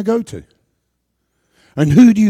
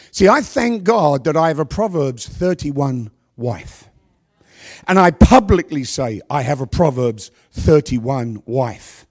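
ordinary talk

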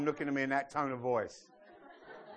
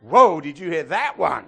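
much louder gleeful exclaim; delighted whoa; 12 dB louder than usual. Real, not acted. Pastor crows to the church over his joke, delighted.

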